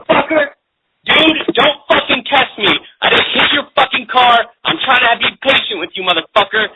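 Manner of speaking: angry shouting. Real, not acted. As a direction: yell at him, barely holding patience. Shouting at the caller.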